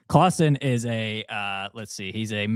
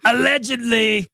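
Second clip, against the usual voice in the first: much higher, loud announced title; high jingle-style shout of the title; dramatic title call; 12 semitones higher, 5 dB louder than usual. Acted. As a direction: call out the segment name big. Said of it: "It is called".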